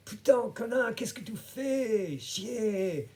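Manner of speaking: high, loud mock French tirade; super upset. Upset cyclist cursing the man he hit, high angry yelling. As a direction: yell insults like an outraged cyclist. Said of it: "he got super upset".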